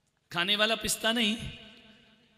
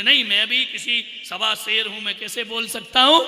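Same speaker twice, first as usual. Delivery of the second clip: proud husband; 2 semitones higher, 8 dB louder than usual. Retold or imitated. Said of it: "लेकिन बोले".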